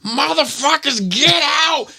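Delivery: very high, shrill-pitched mock screech; dragon-like yell. Drunk mother yelling at kids in her house, high mock screech. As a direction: imitate an angry drunk mother's drawn-out yell. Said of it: "like the dragon".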